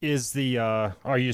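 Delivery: ordinary talk, nothing marked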